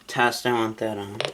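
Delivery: normal talking voice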